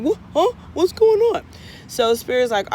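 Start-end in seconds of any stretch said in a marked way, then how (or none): 0.0-1.4 much higher, squeaky startled what; confused yelp, pitched way up; startled turkey. Acted. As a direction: jolt up in cartoon surprise. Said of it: "He stops eating like".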